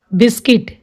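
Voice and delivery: बिस्किट with short i in "kit"; silent U. Shown as a demonstration. Teacher showing learners the word, बिस्किट with silent U. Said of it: "इसको हम बिस्कुट नहीं बोलेंगे".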